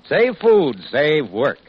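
normal talking voice

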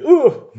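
sharp high yelp of pain. Retold or imitated. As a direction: yelp like winded from a fall. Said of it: "He was like".

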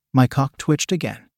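usual speaking voice

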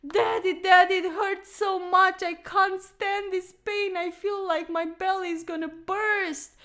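high, loud fake wail of pain; fake crying child. Child fakes belly pain to her dad, high wailing. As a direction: ham up a sick child begging daddy. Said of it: "crawled in the middle of the living room crying".

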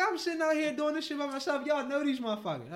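high-pitched comic griping; indignant complaint. Retold or imitated. Driver complaining he fought all by himself, high and indignant.

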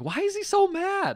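high, loud, drawn-out mock bafflement; baffled outcry. Podcaster recalling their reaction to an angry character, baffled outcry. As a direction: exclaim it high in exaggerated disbelief.

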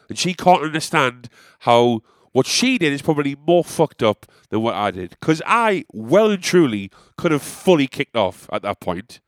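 ordinary delivery

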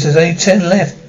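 ordinary talk, nothing marked

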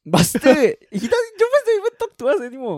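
high, loud laughing protest; laughing outburst. Real, not acted. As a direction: blurt it out through laughter. Mock outrage laughing at friend.

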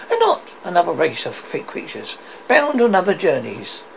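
high, animated speech, rising to a blessing; earnest nephew. Acted. Nephew defends Christmas to his uncle, earnest and lively.

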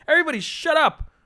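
a high fed-up yell at the bus; shut-up outburst. Acted. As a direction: burst out high and sharp. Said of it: "and she's like".

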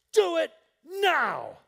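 high, loud, slow command; commanding shout. Acted. Preacher daring the big man, shouting high. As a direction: bark the order right in his face.

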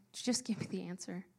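normal talking voice

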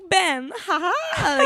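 put-on high, slow Italian-accented flirt with a laugh; mock Italian accent. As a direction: imitate a giggly Italian flirt, drawing it out. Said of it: "it was a good accent you did".

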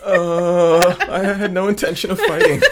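high, loud voice breaking with laughter; laughing mock innocence. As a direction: play an innocent dog while cracking up. Voices sheepish dog, laughing along.

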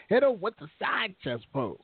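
high-pitched put-on impression voice; Leo Russ. Streamer acting out a chat comment as an impression. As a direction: put on someone else's voice, pitched well up. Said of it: "In my Leo Russ voice".

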